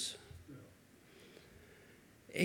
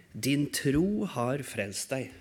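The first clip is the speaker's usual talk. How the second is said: tender reassurance; 4 semitones lower, slower than usual. Acted. Jesus speaks to the healed man.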